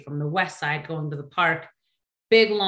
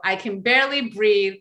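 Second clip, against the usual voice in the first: ordinary talk, nothing marked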